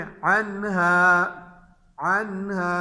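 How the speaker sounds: "अनहा" with a clear n and drawn-out final vowel; izhar recitation. Shown as a demonstration. Teacher gives students an example with ha, clear n in "अनहा". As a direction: sound the n of "अनहा" cleanly, no hum. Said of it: "जाहिर करके पढ़ेंगे".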